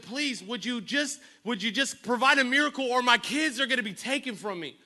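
high, urgent imitation of begging; pleading. Preacher voicing a widow begging Elisha to save her kids, pleading.

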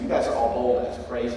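ordinary delivery

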